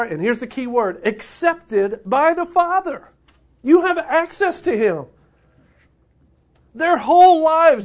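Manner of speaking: full-voiced pulpit preaching; impassioned preaching. Real, not acted. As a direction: drive the point home to the congregation. Preaching with rising intensity.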